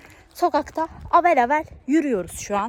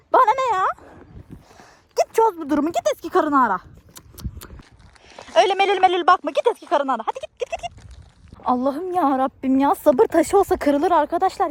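exasperated wife; 5 semitones higher than usual. Acted. Wife caught out in an abandoned building, furious exasperated rant. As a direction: scold him like a wife at her wits' end. Fed-up wife rants at Kabil.

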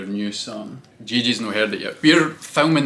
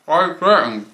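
surprised; 6 semitones higher, 5 dB louder than usual. Real, not acted. Mock disbelief at quick DM.

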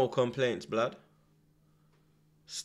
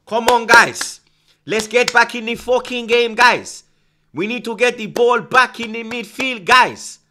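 much louder, higher touchline shouting; imitating Arteta; 12 semitones higher, 14 dB louder, slower than usual. Fan voices Arteta rallying his players, loud and high. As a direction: bellow at the team like a manager on the sideline. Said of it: "Look at Arteta".

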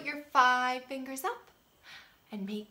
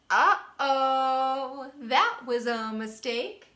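loud mock alarm for children; hammed-up oops to the kids; playful uh-oh; 3 semitones higher, 10 dB louder, much slower than usual.

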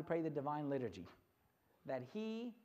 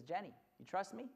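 soft fatherly question; gentle dad; 4 dB quieter than usual. Acted. Dad asking his daughter for trust, gentle and soft. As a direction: ask quietly, inviting trust. Dad asks again at bedtime.

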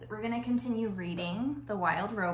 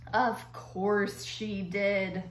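slow, drawn-out grumble; muttered to himself; much slower than usual. A narrator's reading. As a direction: grumble it to yourself, stretching each word. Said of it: "Mister Beaver muttered to himself".

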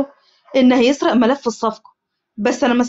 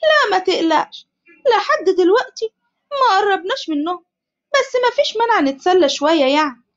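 شرير ساخر; evil mocking; 7 semitones higher than usual. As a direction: toy with them like a villain. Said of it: "عادل وهو بيضحك بشر".

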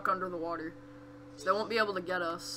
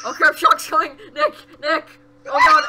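loud, high cries for help; panicked yelling; 6 semitones higher, 13 dB louder than usual. Real, not acted. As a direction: yell for your friend in panic. Monster coming, calls for help.

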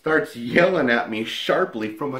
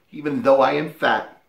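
usual speaking voice

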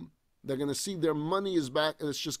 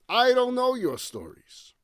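loud, high exasperated cry; father exploding at his sons' stories; angry outburst; 9 semitones higher, 6 dB louder than usual. Acted. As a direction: snap at the sons in frustration. Jacob bursting out at his sons.

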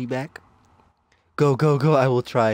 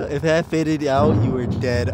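usual speaking voice

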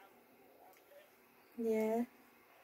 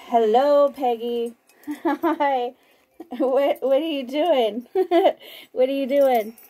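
usual speaking voice

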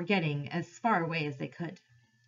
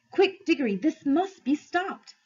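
higher, urgent plea; urgent girl's voice; 8 semitones higher, 3 dB louder than usual. A narrator's reading. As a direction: press him to act fast. Polly urging Diggory.

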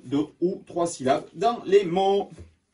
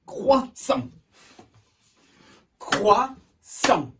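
high "Croissant" split into two beats; syllable by syllable; 7 semitones higher, much slower than usual. Shown as a demonstration. Teacher asks pupils to count, syllable by syllable. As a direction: clap out Crois, sant for "Croissant". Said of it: "Combien de fois?".

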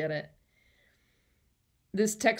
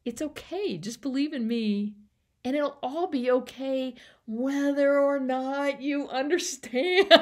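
higher, coaxing, laughing through the end; Jesus reassuring Nicodemus, pitched up and breaking into a laugh; soothing Jesus; 7 semitones higher, 4 dB louder than usual. Acted. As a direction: lift the voice high and coax him kindly. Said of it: "God says".

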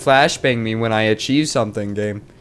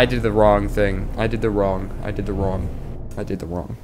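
fading self-scold; 6 semitones lower, 5 dB quieter than usual. Real, not acted. Repeating the mistake, trailing off.

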